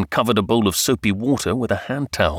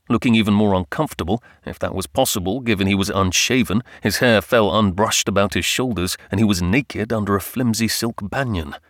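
plain reading voice

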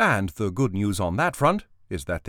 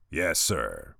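rumbling; 5 semitones lower, 4 dB quieter than usual. Acted. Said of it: "Brick rumbled".

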